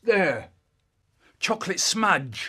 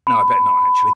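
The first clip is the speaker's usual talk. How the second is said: squeak; 11 dB louder, much faster than usual. Acted. Squeaky aside.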